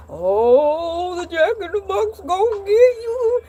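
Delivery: high, teasing spooky warning; teasing parents. Retold or imitated. Parents teasing their little child, high mock-spooky. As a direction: tease the scared child, gonna get you. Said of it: "my mom and dad like".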